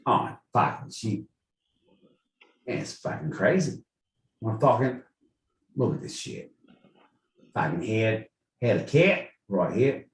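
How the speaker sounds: soft Aussie mutter; Aussie accent. Acted. Guest fumbles his answer, in an Aussie accent. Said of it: "To answer in an Aussie accent".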